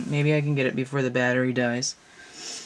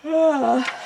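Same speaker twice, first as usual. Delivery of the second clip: a big open yawn, pitch swooping high; loud yawn; 14 semitones higher, 5 dB louder than usual. Real, not acted. Tarot reader yawning at camera, tired yawn. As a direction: yawn out loud mid-reading. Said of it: "Sorry, I'm yawning".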